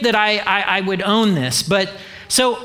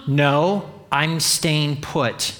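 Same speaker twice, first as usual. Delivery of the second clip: lower, slow, dry refusal; stubborn refusal; 5 semitones lower, much slower than usual. Retold or imitated. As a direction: dig in your heels calmly. Voicing mom refusing church.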